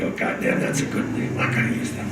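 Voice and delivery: low-voiced, husky private realisation; hushed delight. Writer to himself on hearing a name, hushed delight. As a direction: mutter it to yourself, taken with the find.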